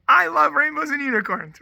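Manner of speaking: high, loud, gleeful blurt; imitating a child's unguarded enthusiasm; childlike declaration. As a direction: say it like an eager little kid. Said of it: "willing to be childlike before God".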